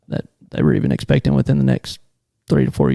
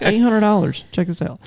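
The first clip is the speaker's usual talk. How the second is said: excited brag; 8 semitones higher than usual. Retold or imitated. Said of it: "Yeah, I'm like".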